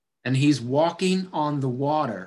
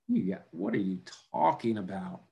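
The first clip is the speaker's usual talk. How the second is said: lower, softer baffled aside; incredulous mutter; 6 semitones lower, 7 dB quieter than usual. Acted. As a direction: mutter in disbelief at him. Other disciples react to Peter.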